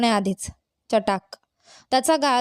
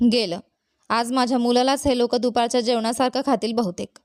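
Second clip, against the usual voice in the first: usual speaking voice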